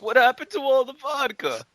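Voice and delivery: high, loud put-on Russian voice; silly Russian voice, squeaky and loud; mock Russian drunk. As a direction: ham up a boozy Russian asking for his vodka.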